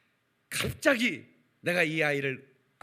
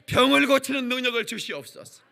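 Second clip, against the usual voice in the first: loud, high pleading prayer; fervent prayer; 7 semitones higher, 9 dB louder than usual. Retold or imitated. Young man praying nightly for the gift of healing. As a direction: plead with God in earnest.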